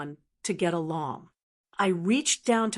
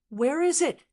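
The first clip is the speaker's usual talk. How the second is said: shouting; 6 semitones higher than usual. Acted. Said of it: "Bradley shouted".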